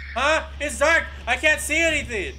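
high, loud alarmed cries; real startle in the dark. Real, not acted. Gamer caught in sudden darkness, alarmed. Lights go out in game.

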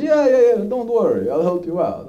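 eager Afghan officer. Retold or imitated. Afghan officer reassures the new lieutenant, eager and high. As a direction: brush off the worry like a too-eager helper. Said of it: "And he says".

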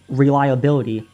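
ordinary delivery, nothing marked

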